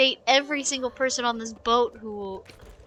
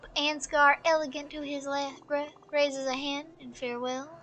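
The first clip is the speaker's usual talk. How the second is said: softer, measured storyteller; story narration; 8 dB quieter than usual. A narrator's reading. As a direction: let the tale unfold in hushed, even phrases. Reads game story aloud.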